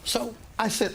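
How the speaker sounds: usual speaking voice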